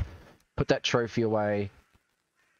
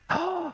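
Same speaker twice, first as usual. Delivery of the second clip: sharp surprised gasp. Real, not acted. Sudden surprise in game.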